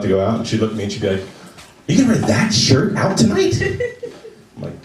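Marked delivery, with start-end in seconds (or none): 1.9-3.6 high, incredulous mock girlfriend; mimicked judgmental girlfriend, pitched up; disapproving girlfriend. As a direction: question it with appalled disbelief. Girlfriend criticizing his outfit.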